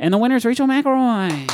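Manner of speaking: big, high presenter voice, far from his own; awards show announcer. Pretend. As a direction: announce the winner with fanfare. Mock Oscars winner announcement.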